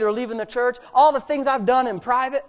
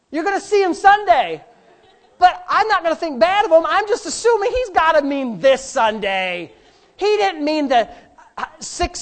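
heavy sarcasm, higher and louder; sarcastic; 6 semitones higher, 6 dB louder than usual. Real, not acted. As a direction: pretend to give him the benefit of the doubt. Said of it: "This is where my sarcasm's coming out".

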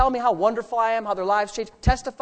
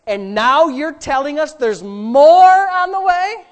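amazed exclamation; 5 semitones higher, 9 dB louder, slower than usual. Retold or imitated. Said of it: "she said".

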